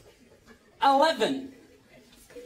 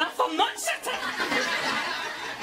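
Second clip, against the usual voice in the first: drawn-out mock Scots; 4 semitones higher, much slower than usual. Imitating angry Scottish lift users.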